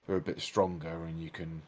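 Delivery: normal talking voice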